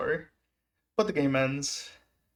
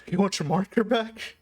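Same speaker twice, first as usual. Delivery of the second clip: lightly voiced player, higher question; imitated player; 6 semitones higher than usual. Player offering a fan his marker back, lightly imitated. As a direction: ask it higher, lightly playing the other man. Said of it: "And he was like".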